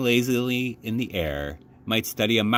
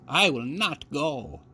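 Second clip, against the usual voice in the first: put-on old woman voice; 6 semitones higher, slower than usual. A narrator's reading. Grandmother voice in read story.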